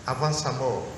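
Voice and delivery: normal talking voice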